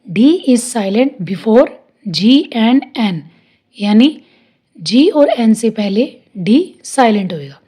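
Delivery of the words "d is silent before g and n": slow, clear rule dictation; teacher dictating slowly; slow dictated rule. Shown as a demonstration. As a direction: read the rule slowly for notes. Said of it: "इसका rule है".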